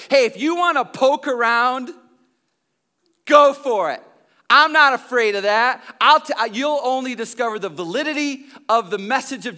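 bold challenge. Retold or imitated. Jesus challenging people today, loud bold dare. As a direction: throw the challenge out wide. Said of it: "Two thousand plus years later".

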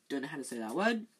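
plain reading voice

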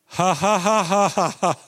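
stiff, dutiful fake laugh; reluctant obedient ha ha; forced laugh. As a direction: push out a laugh you don't feel. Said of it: "That was the best I could do".

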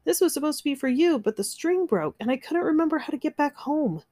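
high-pitched excited child, voiced by a narrator; little sister's squeal. A narrator's reading. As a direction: spill it out in a small, high girl's voice. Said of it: "she squealed".

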